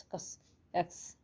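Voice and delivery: कस then एक्स, slow and soft; slow, soft teaching demo of एक्स as कस; X as kas. Shown as a demonstration. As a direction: draw out कस and एक्स slowly to show the sound. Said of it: "थोड़ी सी ऐसी sound आएगी".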